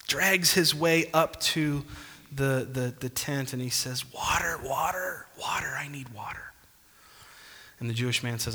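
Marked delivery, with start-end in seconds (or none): 4.1-5.3 thirsty whisper of a man lost in the desert; desperate whisper. Acted. 5.4-6.5 softer pleading whisper, no voice at all; desperate whisper. Acted. Desperate man in the desert.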